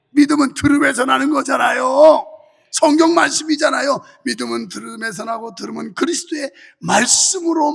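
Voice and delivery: loud, forceful sermon delivery; preaching. Real, not acted. Pastor urging congregation to endure through faith, forceful preaching. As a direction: preach it with driving conviction.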